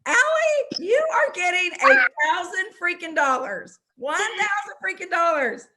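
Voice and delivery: high, loud and jubilant; cheering the winner on; excited. Real, not acted. Celebrating the winner.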